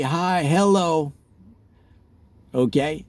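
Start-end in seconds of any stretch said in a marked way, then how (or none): 0.0-1.1 slow, polite hello; drawn-out old-style greeting; slow and mild old-fashioned hello. Retold or imitated. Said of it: "It used to be".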